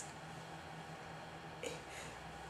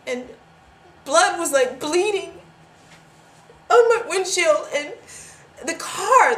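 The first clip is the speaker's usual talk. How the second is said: loud, higher-pitched frantic account; panicked retelling; 4 semitones higher, 10 dB louder than usual. Acted. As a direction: build from raised voice to high, panicked near-shout. Reliving the crash in panic.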